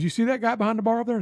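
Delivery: high, fast retold demand; mad drill sergeant. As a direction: lightly imitate an irate Marine. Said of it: "he was mad".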